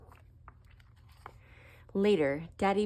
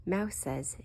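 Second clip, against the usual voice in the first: ordinary delivery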